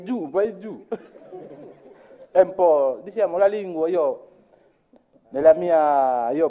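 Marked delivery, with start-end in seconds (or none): 0.0-0.9 higher, laughing go down; imitating an Italian giving directions; laughing instruction. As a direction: point down, amused. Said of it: "Mi dice".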